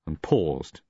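ordinary delivery

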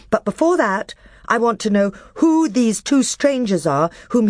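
put-on high, loud young king. A narrator's reading. Caspian answering the dwarf in council, loud and firm. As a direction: stand firm on your word.

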